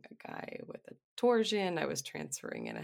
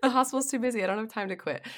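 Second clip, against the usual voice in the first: flustered protest; 7 semitones higher, 9 dB louder, much faster than usual. Retold or imitated. Retelling her flustered reply to husband.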